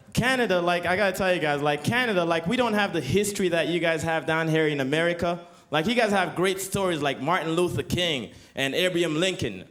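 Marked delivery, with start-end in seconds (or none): none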